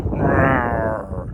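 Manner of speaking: a hammed-up roar as a lion; silly lion growl; playful lion roar. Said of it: "I'm an African lion".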